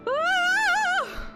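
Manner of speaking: sudden high loud shriek; high scream. Real, not acted. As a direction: let out a piercing cry. Overwhelmed reaction to scene.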